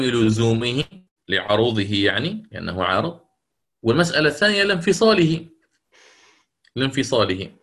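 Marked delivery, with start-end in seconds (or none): none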